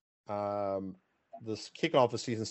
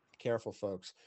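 quiet warning; 2 semitones lower, 8 dB quieter than usual. Shown as a demonstration. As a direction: say it again softly, aside. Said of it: "but be careful, folks".

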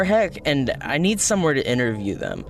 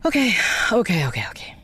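fast nervous whispered okays; nervous self-psyching; 7 semitones higher, faster than usual. Acted. Nervous reporter psyching up before the band arrives. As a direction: whisper to calm yourself down. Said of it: "they're so cool".

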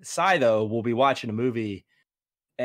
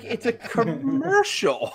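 incredulous dad; 13 semitones higher, slower than usual. Retold or imitated. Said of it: "I'm like".

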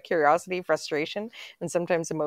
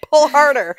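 loud; 10 semitones higher, 13 dB louder than usual. Real, not acted. Playing tug with rope toy.

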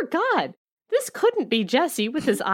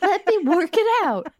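mock confused; 5 semitones higher than usual. Mock heroine doing maths.

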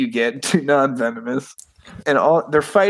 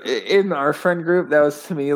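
ordinary talk, nothing marked

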